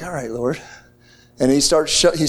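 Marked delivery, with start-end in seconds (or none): 0.0-0.6 hushed, much softer and lower; quiet surrender. Retold or imitated. Preacher recalling answering God, hushed and low. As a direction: murmur it like a private prayer.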